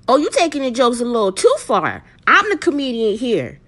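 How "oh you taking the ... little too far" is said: ordinary talk, nothing marked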